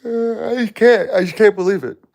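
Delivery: high, strained, near tears; the words crack as grief takes over; voice breaking. Real, not acted. Grieving husband to viewers, voice breaking high and loud. Grieving, cannot believe wife's death.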